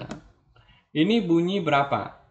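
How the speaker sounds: usual speaking voice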